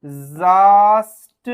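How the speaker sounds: ডিজাস্ট stretched very slowly and loud as one chunk; slow broken-up pronunciation. Shown as a demonstration. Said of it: "এইরকম ভাবে শব্দটা আমি ভাঙবো". (ডিজাস্ট খুব ধীরে জোরে টেনে বলা)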